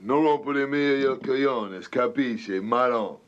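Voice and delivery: tough guy tossing off broken Italian; slow, heavy Italian phrase, wiseguy style; Italian-American gangster slang, delivered slow; mock Italian. Shown as a demonstration. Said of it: "can you speak Italian at all".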